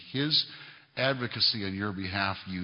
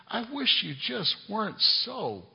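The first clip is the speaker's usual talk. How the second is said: mocking whiny complaint; 13 semitones higher than usual.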